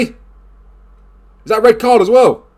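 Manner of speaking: high, loud and drawn out slowly; thrilled disbelief. Real, not acted. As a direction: stretch it out in delighted shock. Foul may bring a red.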